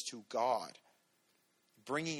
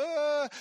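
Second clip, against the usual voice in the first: high short frightened cry; mock fright when life scares us, high yelp; mock scared yelp; 6 dB louder than usual. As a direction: yelp as if startled. Scared reaction to God's path.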